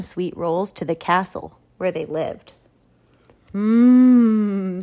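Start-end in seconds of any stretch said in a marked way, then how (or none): 3.5-4.8 long, loud savoring "mmm"; drawn-out hum over a tasty bite; pleased hum. A narrator's reading. Duchess tasting her sweet roll, pleased hum. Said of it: "said the Duchess".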